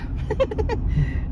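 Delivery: high, airy chuckling; real laugh. Real, not acted. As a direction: break into a long giggle. Laughing after declaring he's back.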